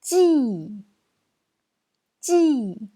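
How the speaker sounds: "Ji" high and loud, falling hard; fourth tone. Shown as a demonstration. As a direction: start "Ji" forcefully high and drop it. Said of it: "C'est le quatrième ton".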